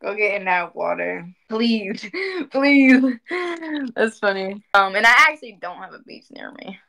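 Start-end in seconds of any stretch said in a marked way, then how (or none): none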